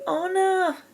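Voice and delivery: high, loud scream of a name; panicked shout. A narrator's reading. Elsa shouting as her sister falls. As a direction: cry out in terror. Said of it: "Elsa shouted".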